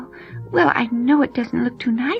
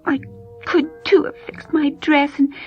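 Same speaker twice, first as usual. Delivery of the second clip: put-on tearful girl; 8 semitones higher than usual. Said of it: "she felt so bad".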